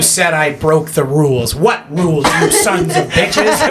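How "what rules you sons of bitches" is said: defiant giraffe voice, slightly higher; Jordan Peterson giraffe. Acted. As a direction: push back at the ride attendant. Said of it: "Jordan Peterson".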